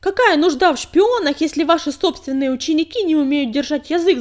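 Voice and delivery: loud, high, scornful challenge; indignant anger. A narrator's reading. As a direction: dare her to deny it. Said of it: "возмущённо выплюнул".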